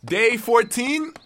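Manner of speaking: slow survival log intro; log entry announcement. Acted. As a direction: announce the day like a diary header.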